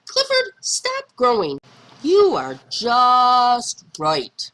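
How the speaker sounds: high, slow, tender plea to the puppy. Acted. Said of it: "I said".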